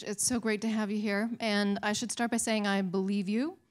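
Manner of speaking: ordinary talk, nothing marked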